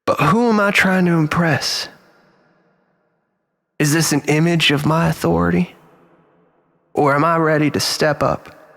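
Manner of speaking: ordinary delivery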